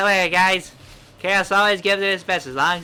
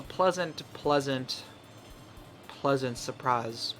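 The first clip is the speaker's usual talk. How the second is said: slowing down, getting quieter; fading shy; 4 semitones lower, 9 dB quieter, much slower than usual. Acted. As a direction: trail off softly.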